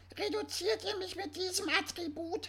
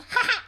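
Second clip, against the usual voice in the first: short laugh; 4 semitones higher, 9 dB louder than usual. Real, not acted. Laughs at own joke.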